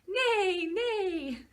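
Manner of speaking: high, loud playful neigh; horse neigh. Acted. Parent answering the child, playing the horse with a high neigh. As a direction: neigh it for the little one.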